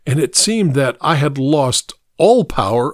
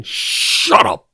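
a sharp rough bark, pitched higher; the gunman barking at his stammering victim; barked order; 9 semitones higher than usual. A narrator's reading. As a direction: cut him off hard. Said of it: "he barked".

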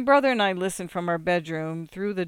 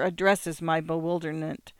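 ordinary delivery, nothing marked

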